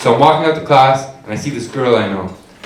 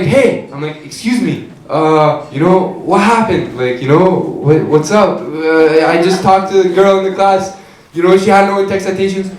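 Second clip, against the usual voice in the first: ordinary talk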